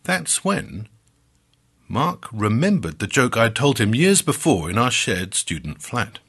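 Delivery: usual speaking voice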